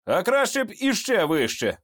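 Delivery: ordinary delivery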